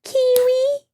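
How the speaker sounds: high sing-song call to the cat; calling a pet. Real, not acted. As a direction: coo the cat's name up high.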